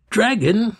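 loud, high, regal command; majestic tone. Acted. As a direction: announce it like a queen to a beast. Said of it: "she announced in her most majestic tone".

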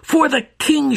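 high, loud war cry; battle scream. A narrator's reading. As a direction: scream it as you strike. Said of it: "she screamed".

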